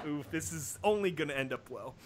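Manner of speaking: plain reading voice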